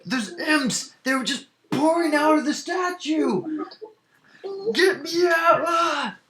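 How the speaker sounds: high, frantic shouting ending in a scream; panicked yelling. Acted. Trapped miner warning of imps, high panicked scream.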